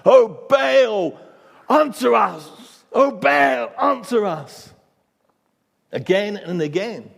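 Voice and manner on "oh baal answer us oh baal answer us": high, loud frantic cry. Retold or imitated. Prophets of Baal crying out to their god, loud and high. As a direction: wail to a deaf god. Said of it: "they cry out".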